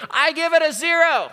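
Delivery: put-on a sulky child shouting his verdict at his dad; kid shouting. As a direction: yell it from the back seat like a defiant kid. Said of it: "I shouted".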